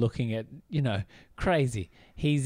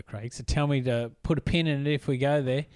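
normal talking voice

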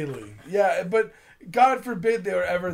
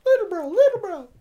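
high-pitched; 12 semitones higher, 4 dB louder than usual. Pretend. Teasing nickname.

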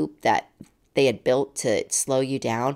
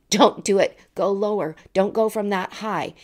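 warning; 7 semitones higher, 5 dB louder than usual. Retold or imitated. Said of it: "to tell him".

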